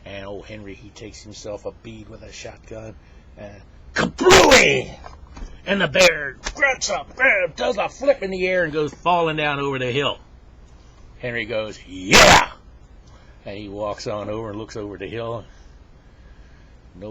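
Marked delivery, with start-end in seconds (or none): 3.9-4.9 huge, high, drawn-out vocal explosion; shotgun blast. Acted. Joke teller imitates Henry's shotgun going off, explosive boom. 5.6-10.2 high, loud, animated narration; excited storytelling. Acted. Joke teller describes the bear blown over, excitedly. 11.9-12.5 very loud victory shout, rising; huge triumphant yell. Acted. Henry sure the shotgun got the bear, exultant yell.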